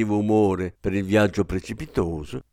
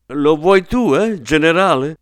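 words still shaking with laughter, louder; laughing mockery; 5 semitones higher, 4 dB louder than usual. A narrator's reading. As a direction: tease him through the laugh. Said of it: "scoppiò in una fragorosa risata". (presa in giro tra le risate)

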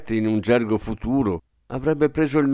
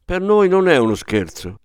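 higher-pitched firm retort; stern reply; 7 semitones higher, 3 dB louder than usual. A narrator's reading. Nurse answering the father's accusation, stern. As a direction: rebuke him severely. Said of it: "in tono severo".

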